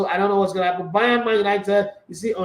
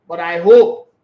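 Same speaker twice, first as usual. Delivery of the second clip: hope said louder than the words around it; stress on hope; 9 dB louder, slower than usual. Shown as a demonstration. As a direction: hit the word hope hard. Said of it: "underline that word hope".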